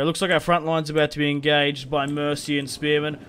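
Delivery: ordinary delivery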